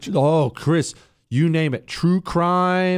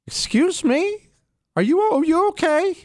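alarmed disbelief. Retold or imitated. Husband startled by wife's serial killer remark, alarmed disbelief. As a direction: replay a taken-aback husband, pitched way up. Replays alarmed reply to wife.

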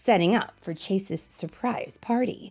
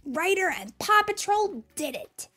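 announcing; 11 semitones higher than usual. Acted. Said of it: "announced through his megaphone".

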